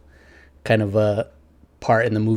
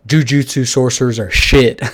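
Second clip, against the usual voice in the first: Nanami's blunt put-on voice, louder; deadpan declaration; 2 semitones higher, 5 dB louder than usual. Recapping Nanami bluntly dismissing sorcerers, louder put-on voice. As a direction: flatly declare it as fact. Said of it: "He's like".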